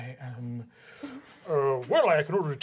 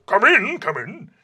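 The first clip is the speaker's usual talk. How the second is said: brisk call through the door, first one louder; vice principal calling; 6 semitones higher, 7 dB louder than usual. Acted. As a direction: beckon a visitor in cheerfully.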